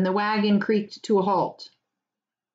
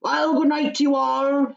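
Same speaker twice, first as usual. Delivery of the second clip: rustic farmer, higher and louder; old farmer voice; 7 semitones higher, 3 dB louder than usual. Acted. Farmer warns the hobbits about fog, rustic voice. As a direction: weigh up the fog like a country farmer. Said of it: "he said".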